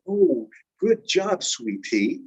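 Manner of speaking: slow SWEETIE with a full, careful T; child's funny pronunciation. Retold or imitated. Little girl playing teacher praises her student, SWEETIE with over-careful T. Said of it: "But she said it funny".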